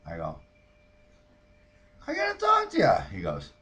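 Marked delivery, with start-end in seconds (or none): 2.1-3.0 high, loud demanding holler; calling out. Retold or imitated. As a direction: shout it across to the neighbour. Calling across to neighbour.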